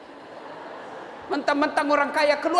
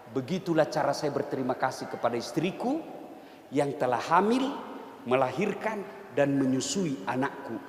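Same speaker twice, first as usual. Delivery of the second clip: softer, lower and gentle; calm sincere reply; 10 semitones lower, 8 dB quieter than usual. Retold or imitated. Umar explaining to his friend, softly and lower. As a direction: speak it with quiet gratitude.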